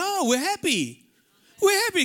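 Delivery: high, loud sing-song "we're happy"; mock cheerful denial. Mocking the deniers, high and singsong. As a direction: parody someone insisting they're fine. Mocking people who deny sadness.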